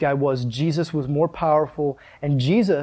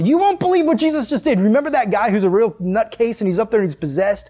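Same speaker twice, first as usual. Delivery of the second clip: much higher, breathless excitement; excited herdsmen; 4 semitones higher than usual. Acted. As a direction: gush the news like astonished herdsmen. Herdsmen spreading the news excitedly.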